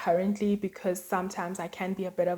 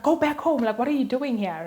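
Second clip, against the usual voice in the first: higher, louder shove-off; annoyed friend yelling; 5 semitones higher, 5 dB louder than usual. Retold or imitated. Best friend sends her home, loud and annoyed. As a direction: shoo someone out of the room. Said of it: "she's like".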